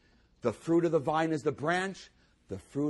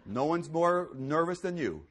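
ordinary talk

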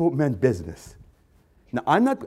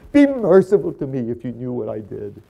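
loud wail sinking into broken begging; anguished plea, easing off; crying plea; 6 dB louder than usual. Acted. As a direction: beg for mercy, falling away from the cry. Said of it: "She's crying".

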